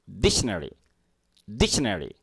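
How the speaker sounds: ডিকশনারি as American "dik-shuh-ne-ree", set apart; American pronunciation. Shown as a demonstration. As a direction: model the American ডিকশনারি, pausing after each. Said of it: "American উচ্চারণ". (ডিকশনারি, American উচ্চারণে)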